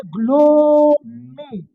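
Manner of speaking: ग्लोमी drawn out slowly, syllable by syllable; slow careful word. Shown as a demonstration. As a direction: say ग्लोमी slowly and clearly, glow-mee. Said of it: "एक और word आ जाता है". (ग्लोमी को धीरे-धीरे साफ़ बोलो)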